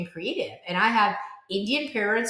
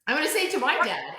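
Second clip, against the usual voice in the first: usual speaking voice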